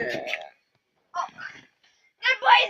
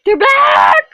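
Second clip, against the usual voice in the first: excited shout; 5 semitones higher, 7 dB louder than usual. Real, not acted. Excited announcement.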